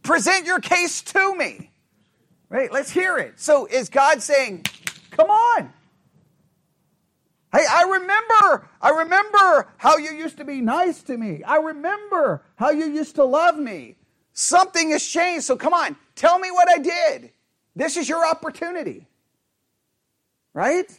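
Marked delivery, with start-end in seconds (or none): 0.0-1.7 slow, raised-pitch summons; firm demand. Shown as a demonstration. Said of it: "It's like". 5.1-19.1 high, insistent appeal; aggrieved challenge. Acted. Acting God challenging the people.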